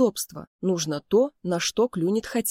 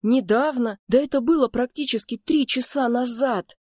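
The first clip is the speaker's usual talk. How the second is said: higher, indignant outburst; getting irritated; 7 semitones higher, 3 dB louder than usual. A narrator's reading. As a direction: throw it back in growing annoyance. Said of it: "начал раздражаться Луи". (раздражённо, с возмущением)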